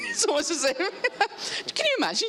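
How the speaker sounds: high, giggling through the words; real laughter breaking into the line; laughing. Real, not acted. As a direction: laugh at yourself mid-sentence. Laughing at her own snobbery.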